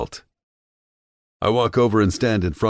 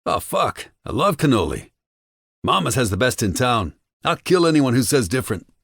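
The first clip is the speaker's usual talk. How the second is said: higher, excited thug voice; eager henchman; 9 semitones higher, 4 dB louder than usual. A narrator's reading. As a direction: gush about the cannoli like a tough guy. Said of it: "Antonio agrees".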